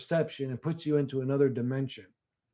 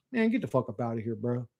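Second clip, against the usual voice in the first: ordinary talk, nothing marked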